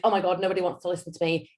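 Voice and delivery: fast, gabbled nervous thought; nervous speaker gabbling through a pitch, rushed; rushing through. Retold or imitated. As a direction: race through it as if nobody wants to hear. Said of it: "Everyone rushes through".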